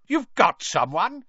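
can't believe they caught someone; disbelieving shout. A narrator's reading. Crouch shouts at the Ministry wizards in disbelief. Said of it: "shouted Mister Crouch".

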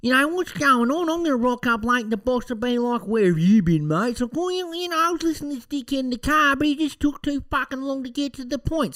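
high-pitched, whiny mock complaint; hammed-up squeaky grumbler; impatient listener. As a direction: whinge it high and fast like a fed-up commuter. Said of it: "you're probably there thinking".

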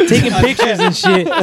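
high slow words squeezed out through a laugh. Real, not acted. Mocking friend taking pictures, laughing hard. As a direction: giggle through the jab.

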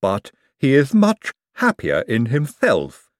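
drawn-out lisping objection; Igor. Acted. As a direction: lisp it as an earnest servant. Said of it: "said Igor".

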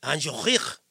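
low, throaty French R in Angelique; mock-French name with a rising end; French pronunciation. Shown as a demonstration. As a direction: overdo the French accent on Angelique. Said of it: "it's a French pronunciation".

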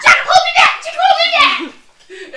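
very high, loud mock shriek; hammed-up fight yell. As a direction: squeal it through laughter, spoiling for a fight. Acting out a fight, calling Jacob.

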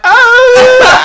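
high squealing laughing oh; a hammed-up gasp of surprise; shrieked oh with a laugh; mock surprised. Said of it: "I went".

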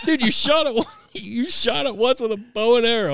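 loud, high-pitched mock scolding; incredulous retelling. Mocking a poacher caught red-handed.

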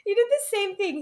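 words squeezed out between high laughs; can barely get the story out for laughing; laughing through it. Real, not acted. Laughing while telling the story.

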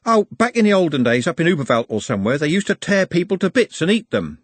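chatty onlooker sharing hearsay; know-all passenger. Acted. Man tells woman about olden days, know-all.

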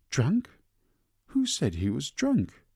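high, indignant outburst; furious retort. Acted. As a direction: snap back at her sister, outraged. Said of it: "Laura turned furiously on Joes".

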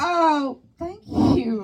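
high, loud mock thanks; awkward fake gratitude. Adult thanks little girl for shirt compliment, high put-on politeness.

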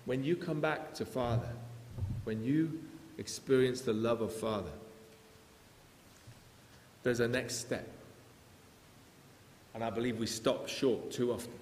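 ordinary talk

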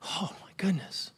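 breathless whisper of shame; hushed, embarrassed whisper; whispered oh my goodness. Retold or imitated. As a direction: whisper it, mortified. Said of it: "And I'm like".

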